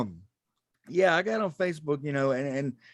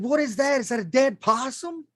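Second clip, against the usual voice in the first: shocked question; 10 semitones higher, 3 dB louder than usual. Retold or imitated. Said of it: "I said".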